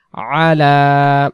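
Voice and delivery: আলা with the আইন pressed from the throat; throaty ain. Shown as a demonstration. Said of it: "আইন অক্ষর গলা চেপে পড়বো". (গলা চেপে আলা)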